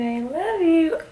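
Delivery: high, cooing I love you; mother cooing love to her infant. Real, not acted.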